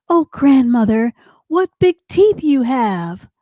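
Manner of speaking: lightly voiced little-girl astonishment; a hint of storybook amazement. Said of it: "she said".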